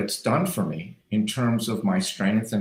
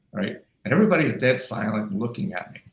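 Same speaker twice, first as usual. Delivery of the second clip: usual speaking voice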